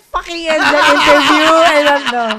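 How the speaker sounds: very loud, high, laughing exasperation; laughing outburst. Real, not acted. Host jokingly calls off the interview, loud laughing outburst. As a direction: shout it in mock outrage while laughing. Mock-ending the interview amid banter.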